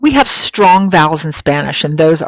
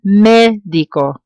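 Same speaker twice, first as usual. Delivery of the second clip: médico, slowly, stretching MÉ; first-syllable stress; 3 semitones higher, slower than usual. Shown as a demonstration. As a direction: hold and punch the start of médico. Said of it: "stress falls on the beginning of the word".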